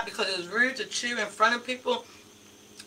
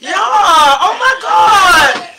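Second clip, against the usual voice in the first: laughing; 5 semitones higher, 7 dB louder, much slower than usual. Real, not acted. Crab falls out while eating.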